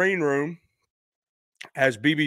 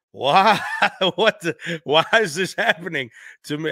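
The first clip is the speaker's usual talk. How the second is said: laughing disbelief; 3 semitones higher than usual. Retold or imitated. Laughing disbelief at meeting B B King.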